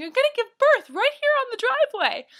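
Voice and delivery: high, loud warning call; calling Jennifer. Retold or imitated. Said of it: "everyone was like".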